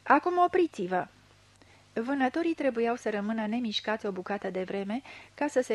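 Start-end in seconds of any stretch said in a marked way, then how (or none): 0.0-1.1 loud, high stopping call; loud command to stop; shouted order. A narrator's reading. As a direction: halt everyone at once.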